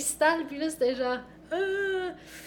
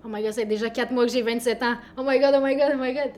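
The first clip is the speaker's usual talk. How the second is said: louder frantic oh my God; panicked; 8 dB louder than usual. Retold or imitated. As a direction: spiral into loud panic. Quoted self panicking about age.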